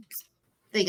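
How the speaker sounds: usual speaking voice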